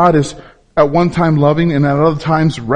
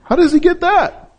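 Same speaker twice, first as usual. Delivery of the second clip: much higher, startled "What?"; baffled surprise; 11 semitones higher than usual. Retold or imitated. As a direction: jump up in pitch like caught off guard. Retold own baffled reaction.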